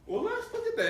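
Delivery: high, amazed exclamation; a star shows up right on cue. Retold or imitated. Celebrity pulls up on cue.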